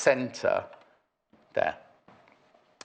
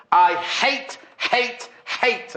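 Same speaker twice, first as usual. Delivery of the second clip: each HATE punched out high and separately; all-caps emphasis; 7 semitones higher, much slower than usual. Retold or imitated. Host reading out a viewer's all-caps message, emphatic. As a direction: read HATE like it's typed in capitals. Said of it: "come through in capital letters".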